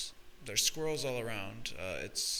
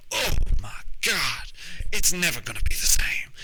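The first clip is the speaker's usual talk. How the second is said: Bob Ross as WWE commentator; 7 semitones higher, 9 dB louder than usual. Acted. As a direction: shout it like a horrified ringside announcer. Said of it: "I'm Bob Ross, and this is W W E".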